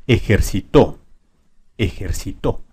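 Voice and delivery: ejercitó stressed ejerci-TÓ; stress on final TÓ. Shown as a demonstration. Teacher moving the stress to to, ejerci-TÓ in ejercitó. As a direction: save the punch in ejercitó for the last syllable. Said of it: "Vamos a ponerla en to".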